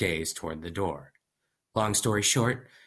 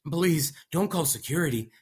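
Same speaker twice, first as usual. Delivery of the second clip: pleading; 8 semitones higher than usual. Acted. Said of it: "I pleaded".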